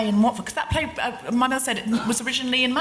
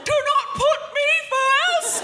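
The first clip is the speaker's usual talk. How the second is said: much higher, louder, slow laughing mock rant; exasperated outburst; 10 semitones higher, 4 dB louder, much slower than usual. Daughter snapping at mother over forgotten buttons, loud mock rant. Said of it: "And you go".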